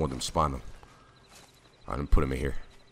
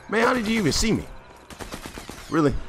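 frustrated disbelief. Real, not acted. Player complaining to the enemy who spotted him, high frustrated cry. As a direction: squeal it out in utter disbelief.

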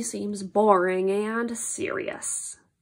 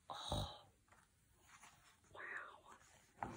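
whispered aside; 28 dB quieter than usual. Acted.

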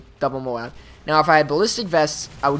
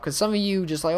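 ordinary talk, nothing marked

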